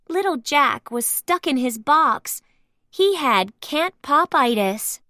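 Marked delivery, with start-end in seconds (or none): none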